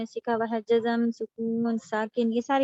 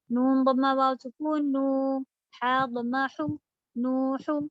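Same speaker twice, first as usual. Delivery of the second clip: slow, careful spelling of نوح; much slower than usual. Shown as a demonstration. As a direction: spell نون ضمہ واو slowly. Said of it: "ایک کی spelling دیکھ لیجیے".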